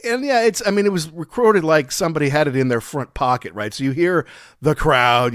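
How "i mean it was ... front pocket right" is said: normal talking voice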